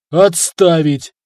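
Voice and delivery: loud, drawn-out command; barked order. A narrator's reading. Prince cutting short the rhetor's excuses, barked order. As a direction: cut him off with a loud bark. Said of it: "рявкнул князь".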